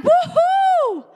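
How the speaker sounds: high, loud cheering whoop; joyful woohoo. Retold or imitated. Preacher cheering after her sick friend lifted her spirits, high joyful whoop. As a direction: let out a cheer of delight. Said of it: "I was like".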